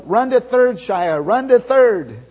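loud high urging yell; shortstop yelling. Retold or imitated. Opposing shortstop yelling Shia toward third, loud. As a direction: holler him around to third. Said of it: "started yelling".

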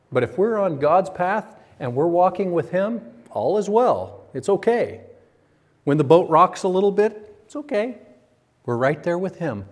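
high, loud reassuring pulpit build; fervent preaching. Acted. Pastor reassuring the church about rocking boats, fervent preaching. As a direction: lift them up with conviction. Reassuring congregation, rising fervor.

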